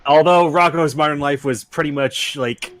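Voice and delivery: ordinary talk, nothing marked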